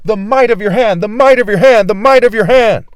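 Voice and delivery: call and response. Shown as a demonstration. Preacher leading viewers to repeat, loud chant. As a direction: drill the phrase with the crowd. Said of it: "Say it with me".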